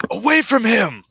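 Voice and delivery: high-pitched heroic yell; crying out. Acted. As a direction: cry out to drive the attacker off. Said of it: "and he cries out".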